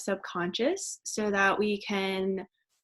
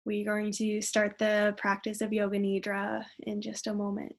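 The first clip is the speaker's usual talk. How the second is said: gentle yoga nidra guidance, quieter at phrase ends; guided meditation; 4 dB quieter than usual. Acted. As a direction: ease them into relaxation, unhurried and soft. Leads yoga nidra relaxation.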